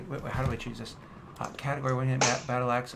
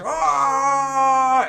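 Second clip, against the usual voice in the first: long, high, loud charging yell; sustained battle scream; intimidating war cry; 12 semitones higher, 11 dB louder than usual. Acted. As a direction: scream it out as you rush in to attack. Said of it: "And you intimidate them".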